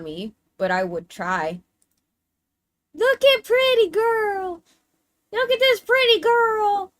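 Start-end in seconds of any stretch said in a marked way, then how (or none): 2.9-6.9 high, slow cooing pet talk; high, louder cutesy pet voice; talking like to a cat. Acted. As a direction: coo at her like a kitten. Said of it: "I'm gonna talk to you like you're a cat".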